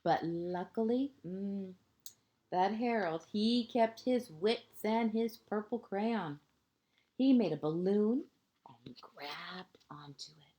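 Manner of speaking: lilting picture-book narration, phrase ends hushed; reading to children. A narrator's reading. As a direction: read it like a bedtime story to a small child.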